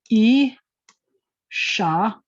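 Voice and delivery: teacher splits E and sha slowly for the class; syllables E, sha apart. Shown as a demonstration. Teacher sounding out E and sha one by one. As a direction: say E, then sha, as separate beats. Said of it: "We have".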